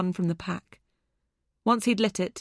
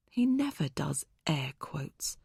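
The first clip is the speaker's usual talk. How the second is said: soft unvoiced aside to herself; whispered thought; 3 semitones lower, 5 dB quieter than usual. A narrator's reading. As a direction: breathe it as a private thought.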